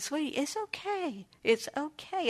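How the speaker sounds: put-on calm a sobbing child; tender soothing. Grandma soothes crying grandson.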